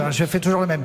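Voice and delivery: usual speaking voice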